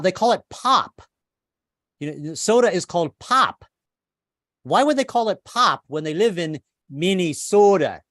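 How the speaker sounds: ordinary talk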